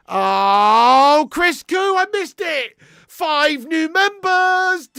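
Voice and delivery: high, drawn-out celebratory shout; excited. Real, not acted. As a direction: stretch it out like a hype announcer. Donation alert, thanking a member.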